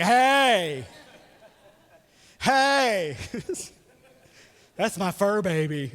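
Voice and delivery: mock doting dog-parent voice with laughs; dog owner gushing. Pastor mimics dog owners, mock doting. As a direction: gush over your fur baby, teasing. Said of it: "they're like".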